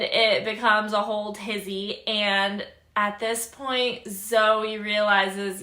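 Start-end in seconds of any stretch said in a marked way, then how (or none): none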